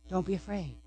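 barely above a breath, soothing; near-whispered reassurance. Acted. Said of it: "The words are this".

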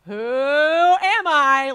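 slow, booming, drawn-out title call; game show announcer. Shown as a demonstration. Host booming the game show title to the Bible study group. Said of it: "I worked on different emphasis".

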